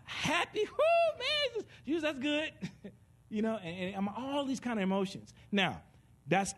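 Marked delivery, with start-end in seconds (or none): 0.0-2.5 high, whooping mock happiness; acted joy. Acting out happiness.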